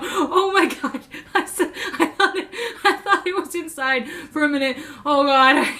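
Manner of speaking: high breathless laughing; laughing relief. Real, not acted. Laughing at her own fright over the wasp. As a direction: giggle through the scare.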